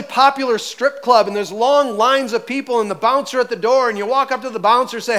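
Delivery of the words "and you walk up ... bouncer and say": usual speaking voice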